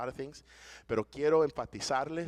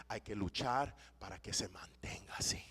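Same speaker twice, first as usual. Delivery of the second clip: whisper; 6 semitones lower, 9 dB quieter than usual. Real, not acted. Urging church to keep unity.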